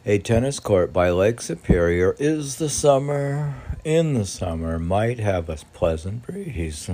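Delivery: loud poem reading. Acted. Reading poem aloud, louder.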